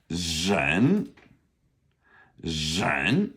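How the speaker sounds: "Zhen" with a long buzzing zh and rising tone; difficult initial. Shown as a demonstration. Teacher modelling a hard initial for repetition, careful pronunciation. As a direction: model "Zhen", draw out the initial, then rise. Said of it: "that's our difficult initial".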